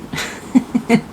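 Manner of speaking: short, breathy chuckle. Real, not acted. Reader amused at so much money, chuckling. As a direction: chuckle briefly to yourself.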